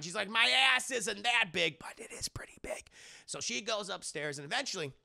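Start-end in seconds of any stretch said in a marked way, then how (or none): 0.3-1.8 high, loud, drawn-out offence; indignant protest. Acted. Offended woman protests in retelling. 1.8-2.9 hushed, voiceless admission; whispered aside. Acted. Muttered admission after the protest.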